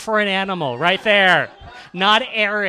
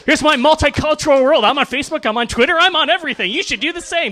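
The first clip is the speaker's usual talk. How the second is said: high, loud, hyped-up self-promoter; Gary Vaynerchuk; 9 semitones higher, 4 dB louder than usual. Acted. Comedian mocking a social media guru's sales pitch, high hyped-up impression. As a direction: push every plug hard, pitched way up. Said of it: "This was sent by Gary Vaynerchuk".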